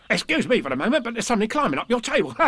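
high, excitable tiger voice; Tigger. A narrator's reading. Tigger alerting Pooh to something climbing the table, high and excited. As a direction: rattle it out as a bouncy tiger. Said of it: "Tigger said".

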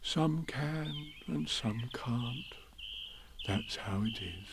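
very slow, soft thinking voice; hushed, drawn-out bear musing; Pooh musing. Acted. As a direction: ponder it slowly and quietly. Pooh muses to himself.

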